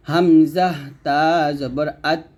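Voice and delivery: হামজা তা জবর আত with audible air on the final ত; breathy final t. Shown as a demonstration. Teacher demonstrating hams breath release. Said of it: "তো এরকমভাবে উচ্চারণ করতে হবে".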